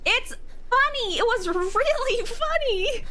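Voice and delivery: high, drawn-out squeal through laughter; laughing protest. Real, not acted. Defends laughing at the deaths.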